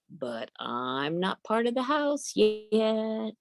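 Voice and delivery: high, slow little dog voice; sly little dog. Acted. Little dog answers the talking gate, high and slow. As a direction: say it slowly like a clever puppy.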